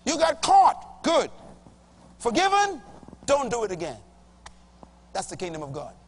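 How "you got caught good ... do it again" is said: judge's verdict. Acted. Acting the forgiving judge.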